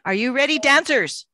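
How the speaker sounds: rally the dancers; calling out. Real, not acted. Calling out to the audience.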